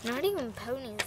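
usual speaking voice

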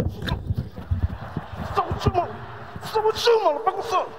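high, stretched "What's up with you" greeting; hyped street greeting. Shown as a demonstration. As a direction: greet a buddy with big energy, drawn out. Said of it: "gotta be in shape to say hi".

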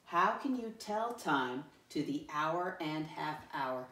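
slow, measured model reading; read-along. Shown as a demonstration. Teacher reads the essential question to pupils, slow read-along. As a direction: read it slowly for learners to follow.

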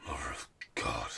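low, hushed whisper. Acted. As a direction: breathe it out in awe.

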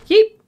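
startled yelp. Real, not acted. Startled by something in game.

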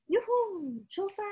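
high, yelped yoo-hoo let's go; excited cheer. Acted. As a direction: launch off with a big whoop. Kitten sets off on date.

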